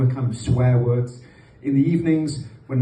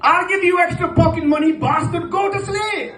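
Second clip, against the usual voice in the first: threatening Pakistani dad. Retold or imitated. Dad threatening noisy kids over pocket money, high mock anger. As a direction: snap it like a dad at bedtime. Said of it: "Then he's like".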